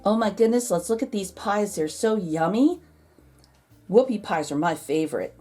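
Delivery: ordinary delivery, nothing marked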